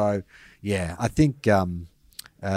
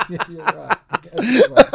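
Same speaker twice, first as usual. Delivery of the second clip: high and laughing; 12 semitones higher, slower than usual. Real, not acted. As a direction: giggle out the concession. Laughing at own mistake.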